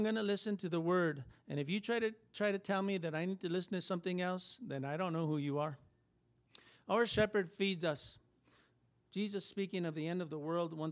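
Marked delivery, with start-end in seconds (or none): none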